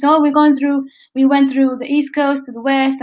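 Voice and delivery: ordinary delivery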